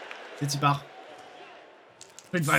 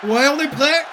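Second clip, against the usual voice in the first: loud, high hype shout; excited shout; 7 semitones higher, 5 dB louder than usual. Real, not acted. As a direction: pump up the crowd. Hype pour le trailer. (cri excité)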